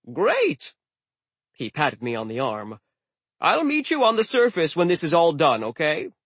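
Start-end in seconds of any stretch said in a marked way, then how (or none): 0.1-0.8 excited. Acted. God accepts cheerfully. 3.4-6.1 cheerful sea god. Acted. God promises to meet.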